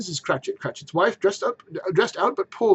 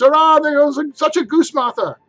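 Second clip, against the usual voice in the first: put-on high, loud children's hurrah; cheering; 11 semitones higher, 10 dB louder than usual. Young Cratchits cheer to Martha, high and loud. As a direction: whoop with delight.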